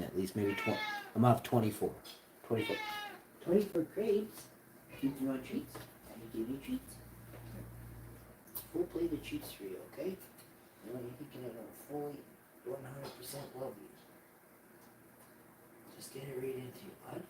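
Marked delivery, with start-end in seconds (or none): 2.5-4.4 excited squeak. Real, not acted. Excited crepe count. 5.0-17.2 pet talk. Real, not acted. Talking to pet with treats.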